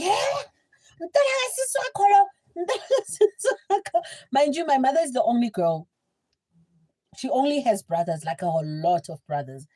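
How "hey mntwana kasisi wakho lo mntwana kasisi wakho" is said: much higher, shrill mock outrage; aunt flipping out. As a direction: shriek like a furious aunt.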